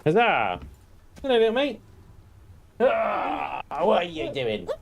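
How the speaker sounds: high sing-song pet talk. Real, not acted. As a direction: coo at the little pet.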